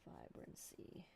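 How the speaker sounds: whispered word; whispering. Acted. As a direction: breathe the word out softly.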